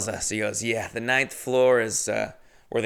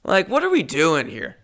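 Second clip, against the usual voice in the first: ordinary talk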